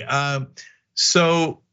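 ordinary talk, nothing marked

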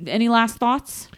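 usual speaking voice

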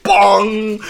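high, loud "bong"; imitated impact noise. Mimicking a coworker's painful fall, loud comic bong. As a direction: mimic a painful clang at full volume.

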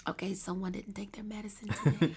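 breathy whispered aside to herself. Retold or imitated. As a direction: whisper it like a private joke. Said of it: "I'm like".

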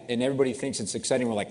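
normal talking voice

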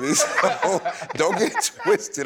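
high, loud laughing tease. Real, not acted. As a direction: laugh while teasing back. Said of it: "I'm talking to you regular".

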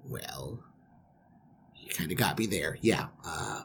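low, soft, halting mumble; sheepish concession. Acted. Groom conceding to his uncle, sheepish. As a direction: give ground reluctantly.

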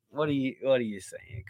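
ordinary delivery

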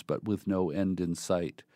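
normal talking voice

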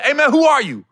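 high, loud, confrontational demand; going off. Retold or imitated. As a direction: confront the stranger hard. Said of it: "went left on him quick".

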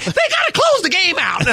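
high mock wife voice, laughing; imitating wife. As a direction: play the annoyed wife lightly. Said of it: "She said".

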